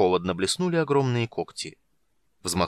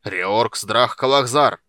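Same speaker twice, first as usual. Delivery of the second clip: very loud, slow spell word; shouted incantation; 9 dB louder, much slower than usual. A narrator's reading. The dwarf shouts a spell to summon his hammer. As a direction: hurl the spell word out slowly. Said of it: "он прокричал".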